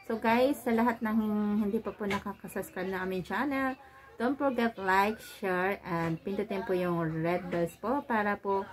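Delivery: muffled behind mask; talking into mask; through a face mask. Real, not acted.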